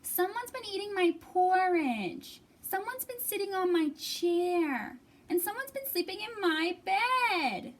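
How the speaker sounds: high, put-upon little bear voice; whiny baby bear. Acted. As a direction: sulk like a small cub.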